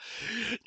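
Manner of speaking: sharp high gasp of disbelief; shocked gasp. Retold or imitated. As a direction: gasp like you can't believe it. Drill instructor reacts in shock.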